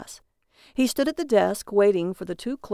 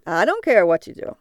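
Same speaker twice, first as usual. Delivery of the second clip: loud, dismissive cab driver; cabbie voice; 6 dB louder than usual. Acted. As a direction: shrug it off like a cabbie.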